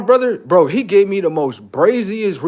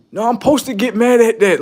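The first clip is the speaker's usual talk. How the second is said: mock stubborn protest; 8 semitones higher, 6 dB louder than usual. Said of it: "They just like".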